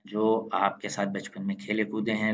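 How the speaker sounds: ordinary talk, nothing marked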